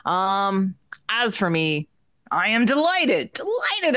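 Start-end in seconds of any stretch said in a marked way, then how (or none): 2.3-3.3 excited. Acted. Host hams up her delight. 3.3-3.9 high squeal. Acted. Host hams up her delight.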